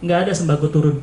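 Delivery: ordinary talk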